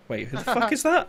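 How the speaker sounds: high, sharp puzzled frown; suddenly baffled at a stranger; frowning surprise. Acted. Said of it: "with a frown of".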